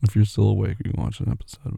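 ordinary delivery

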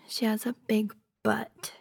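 hushed, sulky whisper. Acted. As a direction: breathe it out in a whisper. Gossiping about coworker.

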